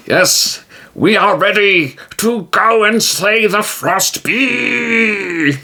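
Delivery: a proud hero booming praise; grand hero voice. Acted. T-Jim ready for the quest.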